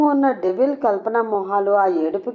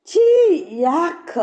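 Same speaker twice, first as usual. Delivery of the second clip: disgusted; 8 semitones higher, 3 dB louder, slower than usual. A narrator's reading. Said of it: "ఏడుపు మొహం పెడుతుంది".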